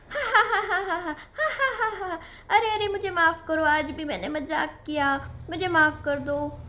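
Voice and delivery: high laughter then a quick mock sorry; laugh, then rush a cheeky apology. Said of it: "फिर से मेमना हंसने लगा". (हँसते हुए माफ़ी)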